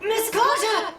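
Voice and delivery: urgent lookout cry; urgent report. Acted. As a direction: warn the captain at once. Lookout reports enemy ship.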